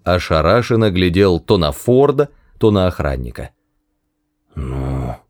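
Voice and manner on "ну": low soft mutter. Acted. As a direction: mumble it under breath. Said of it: "пробормотал Вагон".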